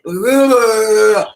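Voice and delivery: long, loud mimed retch; word-vomit retch. Shown as a demonstration. Psychic showing the word vomit she feels, loud retching noise. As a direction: heave it up from the belly. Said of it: "I feel like I'm having word vomit".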